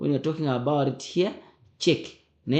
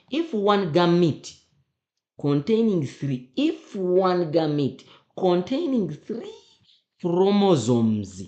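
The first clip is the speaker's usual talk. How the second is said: higher, firm stress on "three" and "chromosomes"; emphatic re-reading; 4 semitones higher than usual. Shown as a demonstration. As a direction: read it out pointedly, drawing out "three". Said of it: "let's read again".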